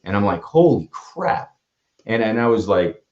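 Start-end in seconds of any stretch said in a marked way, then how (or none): 0.4-1.5 high, drawn-out exclamation of shock; stunned disbelief. Retold or imitated. Speaker retells his reaction to a near suicide, stunned. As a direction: stretch it slowly, pitched well up, reeling.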